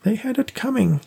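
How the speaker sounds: put-on high, stubborn old woman voice; insistent old woman. A narrator's reading. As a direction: insist it like a bitter old lady. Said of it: "Rose insisted".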